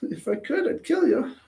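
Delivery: mock threat. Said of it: "You're like".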